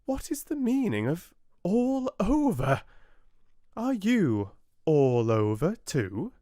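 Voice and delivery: high, piping tiny fly voice; little fly. A narrator's reading. Tiny fly questions the oak, high piping voice. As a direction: squeak it out like a tiny insect.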